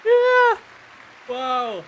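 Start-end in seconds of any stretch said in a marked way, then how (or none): 0.0-0.6 loud, very high whoop; excited cheer. Real, not acted. Streamer cheering at the game, excited cheer.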